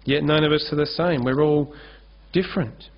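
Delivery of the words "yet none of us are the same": usual speaking voice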